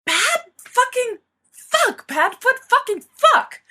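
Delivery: furious outburst. A narrator's reading. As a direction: sputter curses in rage at a friend. James furious at Sirius.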